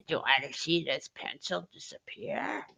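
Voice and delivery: whispered Joker impression. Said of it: "the Joker's like".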